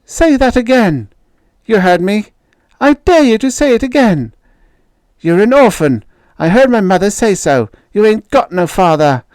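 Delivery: loud, high jeering threats; a schoolyard bully daring him; bully taunting. Acted.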